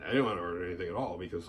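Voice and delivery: normal talking voice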